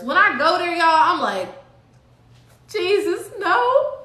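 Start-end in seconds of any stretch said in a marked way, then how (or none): 2.7-3.9 high, crying mock plea; hammed-up desperate prayer at the cell door; pleading to God. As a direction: beg Jesus half-laughing, half-wailing. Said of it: "this is me pleading to the Most High".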